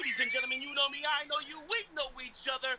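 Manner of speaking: self-hyping wrestler promo; wrestling hype. Pretend. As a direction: shout your own entrance.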